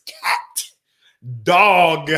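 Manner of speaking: "Cat" and "dog" separated, each sound carefully formed; speech-class drill on "Cat" and "dog"; over-enunciated. Retold or imitated. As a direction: crisply enunciate "Cat", pause, then "dog". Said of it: "I had to enunciate my words".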